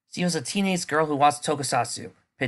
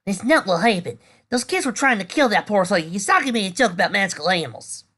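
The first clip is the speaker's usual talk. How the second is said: indignant defense; 9 semitones higher, 6 dB louder than usual. Acted. Friend defending loudly to gossips.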